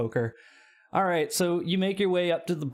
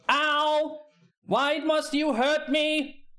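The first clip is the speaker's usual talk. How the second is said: whiny robot; 13 semitones higher, much slower than usual. Acted. Robot knocked across room.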